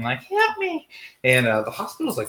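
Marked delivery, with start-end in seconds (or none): 0.2-0.9 very high, thin mock-ghost plea. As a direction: imitate a ghost calling out, way up high. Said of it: "some ghost voice going".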